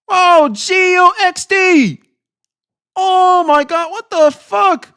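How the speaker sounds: high, loud, stretched-out hype; astonished excitement. Real, not acted. Streamer shocked by a gift flooding the chat, loud high astonishment. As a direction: holler in real disbelief.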